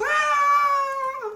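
high shrieking scream; crowd screaming. Acted. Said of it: "everybody around goes".

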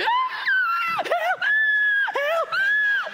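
very high, loud screams and yells for help; panicked screaming. Retold or imitated. Preacher reenacting the boy thrashing in the pool, frantic high screams. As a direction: scream and call for help desperately. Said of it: "That's all you heard".

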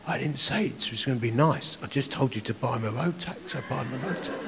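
gentle inner rebuke; quiet voice of the Spirit. Retold or imitated. Holy Spirit quietly insists the preacher buy the road tax, soft and firm. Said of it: "the Holy Spirit saying to me".

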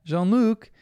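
high mock yell; calling from afar. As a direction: imitate a French parent calling a child in.

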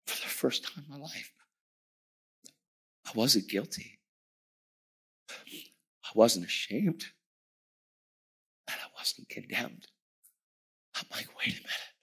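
near-whisper, with long pauses; awed hush. Retold or imitated. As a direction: let it out softly, in stunned disbelief. Reliving moment he felt forgiven.

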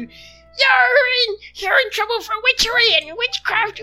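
high, loud self-righteous accusation; pompous villager accusing. Acted. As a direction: denounce them to the crowd. Mob leader denounces witches.

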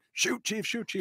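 a hint of Nicholson egging the chief on; Jack Nicholson urging. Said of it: "Jack Nicholson's like".

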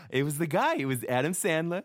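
put-on light slurred New York accent, tipsy; lightly imitating Dorinda mumbling the name; drunk Dorinda impression. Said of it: "And she's like".

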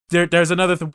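ordinary talk

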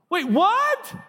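high squeak of disbelief; surprised. Pretend. Preacher fakes shock at a strange idea, high squeak. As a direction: jump way up in mock shock.

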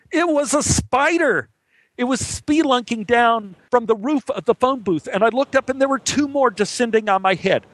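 high-pitched, alarmed narration; alarmed storytelling. A narrator's reading. Man recounts spiders descending in phone booth, alarmed. Spider drops before his eyes.

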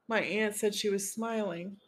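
usual speaking voice

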